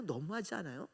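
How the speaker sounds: quiet echo. Acted. Preacher repeating the question to herself, hushed. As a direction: let the question sink in softly. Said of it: "repeated appeal".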